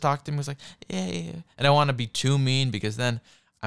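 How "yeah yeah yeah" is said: soft, higher-pitched mock murmur; mock whispering commentator. Mocking commentators who sound like a therapist, mock hushed voice. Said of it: "they're whispering ... listening to my therapist".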